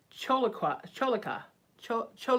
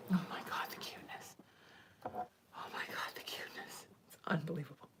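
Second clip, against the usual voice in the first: whispering; 5 semitones lower, 11 dB quieter, faster than usual. Real, not acted. Whispering over the cute cat.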